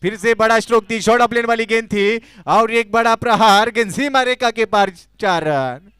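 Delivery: loud high boundary call; excited cricket commentary. Real, not acted. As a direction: belt out the four.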